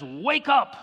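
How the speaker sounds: high-pitched mock yell of a mother; retold yell. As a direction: call out like a mom waking a kid, not full volume. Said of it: "and she yells".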